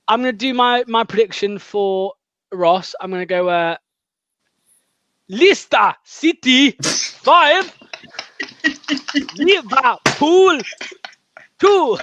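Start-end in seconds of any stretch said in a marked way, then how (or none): 5.3-12.0 high, slow, drawn-out football results reading; mock results announcer. Host hamming up his score prediction in a slow, high results-announcer voice. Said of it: "Premier League results".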